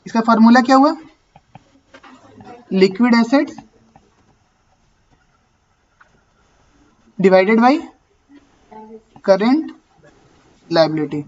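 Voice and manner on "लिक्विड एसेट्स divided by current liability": word by word, long pauses between; dictating. Real, not acted. Teacher writing out the ratio formula for the class, dictating. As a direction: dictate slowly for someone writing it down.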